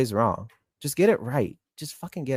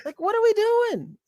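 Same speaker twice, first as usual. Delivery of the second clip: very high-pitched, incredulous plea; exasperated. Real, not acted. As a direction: let the voice shoot up in real exasperation. Exasperated rant about the race officiating.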